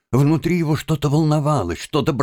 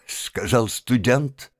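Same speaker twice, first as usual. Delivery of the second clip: ordinary delivery, nothing marked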